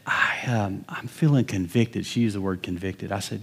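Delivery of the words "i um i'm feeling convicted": hesitant confession. Retold or imitated. Wife confessing to pastor.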